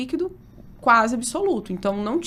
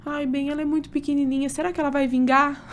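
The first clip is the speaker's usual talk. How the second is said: higher-pitched retold worry; mildly anguished, higher voice; worried mother; 5 semitones higher than usual. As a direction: imitate an anxious mother fretting. Retells mother's anguished worry about baby.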